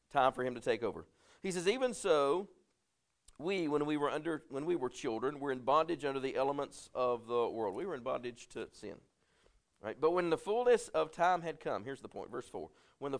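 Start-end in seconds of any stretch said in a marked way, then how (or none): 1.7-7.7 scripture reading. Acted. Preacher reading scripture to the congregation, raised and measured. Reads scripture aloud in sermon. 10.0-11.8 raised reading voice, fullness stressed; scripture reading. Acted. Preacher reading Paul to the congregation, raised. Reads scripture, first take.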